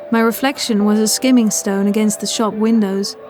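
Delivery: plain reading voice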